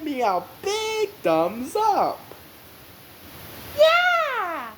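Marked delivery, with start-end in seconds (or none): 3.8-4.7 a big high, loud cheer; excited cheer. Real, not acted. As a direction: burst out with a high yelp of joy. Cheering for thumbs up.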